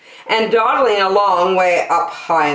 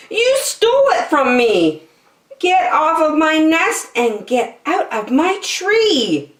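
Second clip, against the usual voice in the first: angry Maisie; 10 semitones higher than usual. Acted. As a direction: drive the elephant off the nest. Maisie ordering Horton away.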